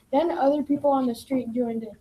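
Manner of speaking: ordinary talk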